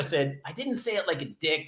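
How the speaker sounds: ordinary talk, nothing marked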